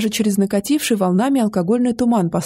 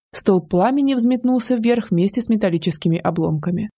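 ordinary delivery, nothing marked

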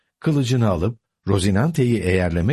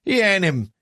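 called out; 11 semitones higher than usual. A narrator's reading. Uncle interrupting his niece, called out in protest. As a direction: cry out to stop her. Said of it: "diye bağırdı Don Kişot".

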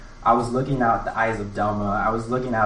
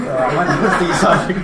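louder, higher, giggling through it; can barely get it out for laughing; retold answer breaking into laughter; laughing reply; 7 semitones higher, 7 dB louder than usual.

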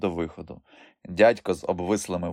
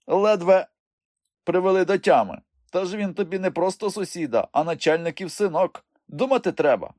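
high-pitched reproachful elder; scolding old uncle; 10 semitones higher than usual. A narrator's reading. Old uncle warning Ivan about the official's son, scolding. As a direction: chide him in a raised pitch.

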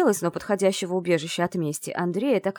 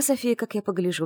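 ordinary delivery, nothing marked

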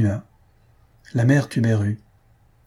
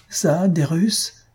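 slow, higher, drawn-out indignant question; outraged disbelief; 7 semitones higher, much slower than usual. Acted. Maroquin scoffing at the spectator, outraged. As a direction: scoff at the idea, dragging each word. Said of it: "hurle le Maroquin".

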